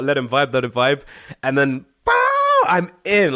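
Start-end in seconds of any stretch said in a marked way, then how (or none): 2.0-3.3 explosive pow. Retold or imitated. His big guitar entrance.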